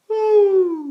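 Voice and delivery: very high falsetto note. Shown as a demonstration. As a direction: float it up into falsetto. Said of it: "from the falsetto".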